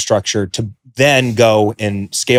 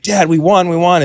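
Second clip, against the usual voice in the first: higher, eager retelling of a win; excited; 7 semitones higher than usual. Retold or imitated. Telling his dad on the phone about the auction win, excited. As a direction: blurt it out like a thrilled kid.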